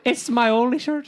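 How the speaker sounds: high, loud Irish accent impression; high mock Irish voice. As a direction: echo the line in a put-on Irish lilt. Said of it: "quoting Kendra's line again".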